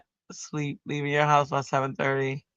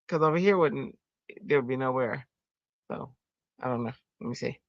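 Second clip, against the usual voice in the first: ordinary delivery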